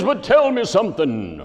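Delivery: high, loud, long-drawn preacher wail; preacher's voice. Retold or imitated. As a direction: intone the question like a hammed-up pulpit sermon. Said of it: "that's the way he talked right there".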